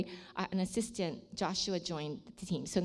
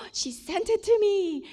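gasp of surprise; 12 semitones higher, 5 dB louder than usual. Retold or imitated. Gasps opening the graphic.

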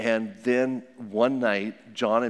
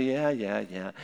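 bored brush-off; 2 semitones lower than usual. Retold or imitated. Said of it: "they'll say".

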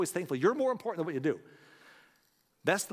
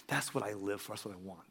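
moved and hushed; 7 semitones lower, 9 dB quieter than usual. Real, not acted. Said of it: "I got goosebumps right now".

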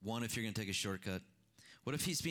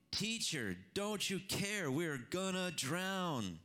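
mild mock shout; 6 semitones higher, slower than usual. A narrator's reading. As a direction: cry out the disciples' panic without full volume.

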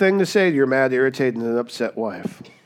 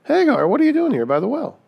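much higher, louder, mock-cheery hello; casual surprised greeting; 9 semitones higher, 5 dB louder than usual. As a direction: pretend he just bumped into her.

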